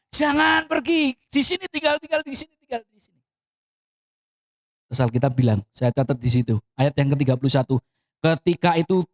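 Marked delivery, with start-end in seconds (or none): 0.1-3.2 high, insistent imitation of begging; urgent retold pleading. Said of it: "sangat mendesaknya". (memohon mendesak, menirukan)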